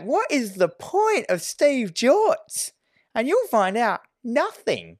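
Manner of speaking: high, drawn-out exasperated question. Retold or imitated. Podcaster ranting about a pointless subplot, exasperated. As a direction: stretch it out high in mock despair.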